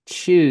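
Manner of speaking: "Shu" hissed through the teeth; through gritted teeth. Shown as a demonstration. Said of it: "you kinda say it through your teeth".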